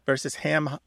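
ordinary delivery

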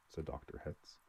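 plain reading voice

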